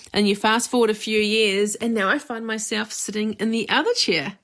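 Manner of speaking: ordinary talk, nothing marked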